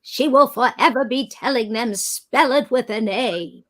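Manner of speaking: imitating a grand, wavering old-film star; Katharine Hepburn. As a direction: pronounce it like a godmother's decree. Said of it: "my godmother and namesake was Katharine Hepburn".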